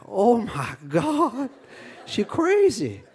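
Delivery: high, slow mock dread; hammed-up stunned disbelief, drawn out; shocked groom. Groom in carriage after horse is shot, high mock alarm.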